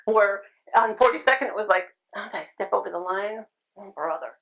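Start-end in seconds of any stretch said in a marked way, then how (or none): none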